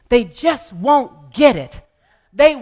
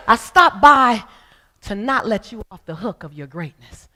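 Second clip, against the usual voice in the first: ordinary talk, nothing marked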